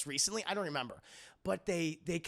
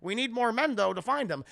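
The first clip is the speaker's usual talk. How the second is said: Han; 4 semitones higher, 7 dB louder than usual. Retold or imitated. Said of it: "he's like".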